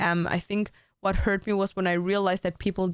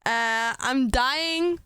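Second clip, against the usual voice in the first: very slow, drawn-out mock dying; dying words; 4 semitones higher, much slower than usual. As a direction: drag it out weakly. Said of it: "I'm pretending like I'm dying".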